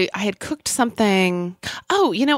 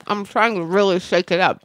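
ordinary delivery

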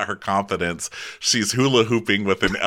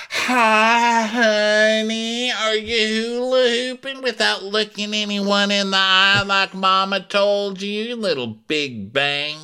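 pitched-up gushing mother; mom voice; 13 semitones higher, slower than usual. Retold or imitated. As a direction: coo at her daughter like a doting mom. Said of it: "and she's like".

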